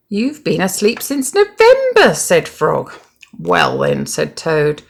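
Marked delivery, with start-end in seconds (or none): 0.1-2.1 bright Frog. A narrator's reading. 3.4-4.1 drowsy low Toad. A narrator's reading.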